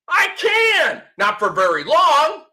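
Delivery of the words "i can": mock boast.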